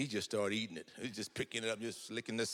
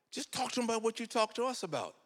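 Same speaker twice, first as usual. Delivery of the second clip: pleading granddaughter; 13 semitones higher, 5 dB louder than usual. Retold or imitated. Back-and-forth with granddaughter.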